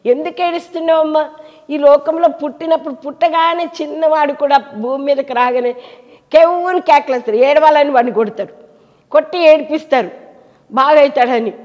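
high, loud impassioned sermon; fervent preaching. Real, not acted. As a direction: plead loudly with the listeners. (ఉద్వేగంగా బిగ్గరగా బోధించడం)

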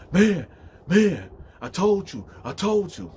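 high-pitched, punchy retold rant; loud first words, then insistent; heated scolding. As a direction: act out an angry son. Son rants at fallen mother.

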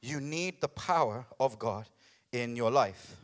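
usual speaking voice

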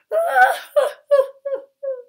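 high hammed-up boo-hoo wail; theatrical loud crying; loud sobbing. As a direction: bawl in loud, high bursts. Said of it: "Sobbing loudly".

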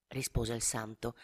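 usual speaking voice

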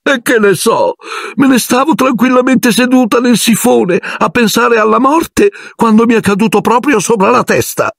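a self-pitying ghost telling her grievance. Acted. Ghost girl explaining to Harry how the book fell, high sulky whine.

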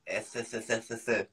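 "S, S, S" as soft lisped hissing; mock lisp. Friend making fun of another's speech, "S" repeated with a mock lisp. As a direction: imitate a lisp on each quiet "S".

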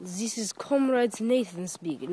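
put-on Russian accent. Vlogger introduces himself as Comrade Nathan, Russian accent. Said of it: "my Russian accent".

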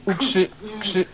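stutters through the phrase, repeating first syllables; stammering jailer. Acted. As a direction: get stuck on every word before it comes out. Jailer stammers each word. (jąkanie, powtarzane pierwsze sylaby)

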